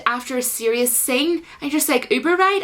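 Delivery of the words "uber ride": high, rising incredulous echo; baffled question. Retold or imitated. Viewer reacting to the Uber joke, baffled. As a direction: toss it back in mock bewilderment.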